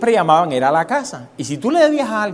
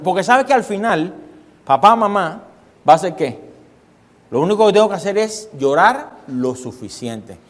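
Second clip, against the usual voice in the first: normal talking voice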